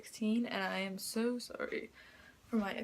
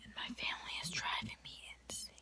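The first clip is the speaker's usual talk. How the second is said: hushed joking complaint; whispering; 4 semitones lower, 11 dB quieter, faster than usual. Real, not acted. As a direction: whisper a mock plea for help. Joking complaint about her family.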